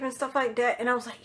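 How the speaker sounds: usual speaking voice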